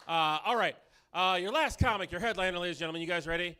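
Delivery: loud, high emcee hype. Real, not acted. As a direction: pump the crowd up. MC hyping the crowd.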